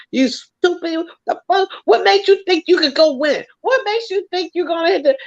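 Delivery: high-pitched berating of herself; cussing myself out. Retold or imitated. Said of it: "cussing myself out".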